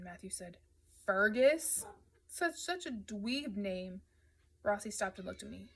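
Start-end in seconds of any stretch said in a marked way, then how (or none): 1.0-4.0 loud incredulous name, then slow scorn; mocking. A narrator's reading. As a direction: question the name loudly, then draw out the insult. Matthew mocks the name.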